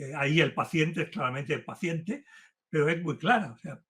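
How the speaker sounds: ordinary delivery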